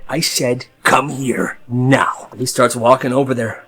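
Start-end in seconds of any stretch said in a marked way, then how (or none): 0.1-2.3 stern order. Retold or imitated. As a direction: drag it out slowly like a threat. Guard repeats the order.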